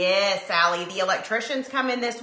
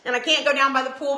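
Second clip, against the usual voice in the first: ordinary talk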